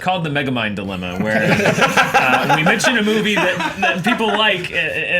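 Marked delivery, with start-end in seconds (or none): 1.4-5.2 laughing. Real, not acted. Laughing mid-sentence.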